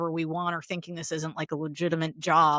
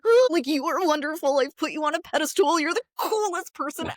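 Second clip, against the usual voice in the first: higher, gushing admiration; admiring gush; 8 semitones higher than usual. Retold or imitated. Praising her mentors, higher gushing admiration. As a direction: fawn over a mentor. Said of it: "I was like".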